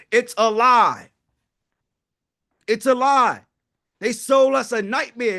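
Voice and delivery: loud, punchy it's a lie; a firm, slow declaration; emphatic outcry. Real, not acted. Preacher-like outburst about retirement.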